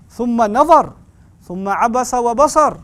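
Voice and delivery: fast melodic Arabic chant; Quran recitation. Acted. Preacher quoting the verse, recited fast. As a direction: recite the verse flowing and quick.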